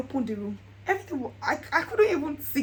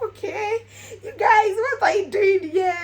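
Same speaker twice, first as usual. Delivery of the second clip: much higher and louder shocked greeting; startled happy cry at the family; excited surprise; 9 semitones higher, 9 dB louder than usual. Retold or imitated. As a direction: shriek with delight at the guests. Retold surprise at guests.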